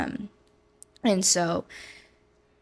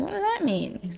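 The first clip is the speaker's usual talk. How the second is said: much higher, incredulous; baffled question; 10 semitones higher than usual. Retold or imitated. Answering the roommate's claim, high baffled disbelief. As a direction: ask it in disbelief. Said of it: "I was like".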